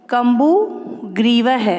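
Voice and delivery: कम्बुग्रीवः slowly, split after कम्बु; pronunciation demo. Shown as a demonstration. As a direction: model कम्बुग्रीवः in two slow parts. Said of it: "मैं आप लोगों को सिखाती हूँ". (कम्बुग्रीवः धीरे, तोड़कर उच्चारण)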